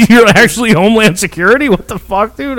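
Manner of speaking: high-pitched, astonished questioning; retold incredulity. Teller replaying the Homeland Security phone call, incredulous. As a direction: act out being floored by the caller. Retells reaction to agent's call.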